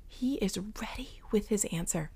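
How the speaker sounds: soft hushed whisper; whispered narration. A narrator's reading. Preacher whispering the story to the congregation. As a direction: whisper it with awe.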